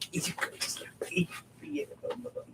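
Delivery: whispering. Real, not acted. Cooing to the dog.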